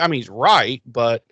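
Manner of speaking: usual speaking voice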